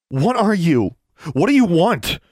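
high, loud sharp demand. Acted. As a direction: demand an answer forcefully. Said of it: "Alexandra demanded".

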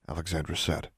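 usual speaking voice

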